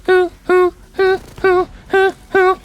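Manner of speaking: falsetto owl hoots, loud; high hooting. Pretend. Streamer hoots in falsetto at the news, owl-like. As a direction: hoot like an owl.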